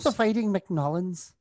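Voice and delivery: slow, high, hammed-up Irish accent; bad American's Irish accent; mock Irish accent. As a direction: drawl it like a fake Irishman. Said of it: "I'm basically Mel Gibson in Braveheart".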